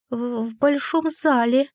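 a touch of stammering fear; timid babble. A narrator's reading. Frightened servant answering the heroine, timid stammer. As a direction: stumble out the words timidly. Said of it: "пролепетала женщина с крыльями".

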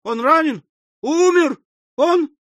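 high-pitched spinster aunt working up to a fit; rising theatrical hysteria; hysterical. A narrator's reading. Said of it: "начался припадок номер второй истерического смеха".

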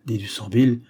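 ordinary delivery

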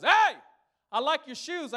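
high, loud, overly friendly stranger; mock-cheery hello from the guy with a gun; sing-song fake-friendly compliment; friendly mugger. Robber voiced, friendly opener.

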